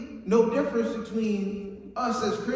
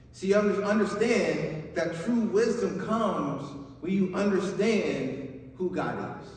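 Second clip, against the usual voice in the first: usual speaking voice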